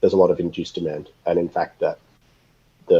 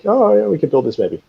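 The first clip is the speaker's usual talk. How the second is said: higher, breezy mock voice of a hopeful rookie; naive young dreamer; 10 semitones higher, 4 dB louder than usual. Mocking rookie rocket founders, a high breezy dreamer voice. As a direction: toss it off like a carefree kid with seed money. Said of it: "the kids who've got some seed money are like".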